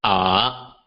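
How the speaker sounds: "ah" dipping down then rising; "ah" as Mandarin third tone, falling then rising. Shown as a demonstration. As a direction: drop "ah" low and bring it back up. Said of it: "So it sounds like".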